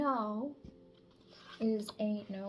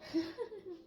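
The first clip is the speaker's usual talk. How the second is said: short laugh; 10 semitones higher than usual. Real, not acted. Laughs at own singing.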